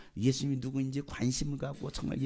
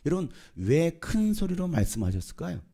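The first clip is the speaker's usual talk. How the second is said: ordinary talk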